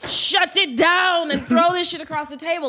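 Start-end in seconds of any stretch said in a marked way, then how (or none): 0.1-1.3 high, forceful order; mock judge's command. Showing what a harsh judge should say, mock yell. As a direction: bellow it like a TV tyrant. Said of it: "need somebody to say".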